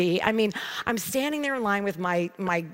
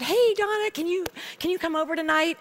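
put-on much higher, quick girlish friend voice; higher voice, a touch faster on the invite; friend Kathy; 9 semitones higher than usual. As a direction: pitch way up for the pleading friend. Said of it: "And she said".

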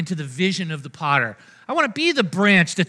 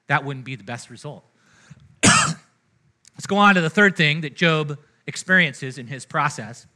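usual speaking voice

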